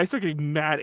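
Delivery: normal talking voice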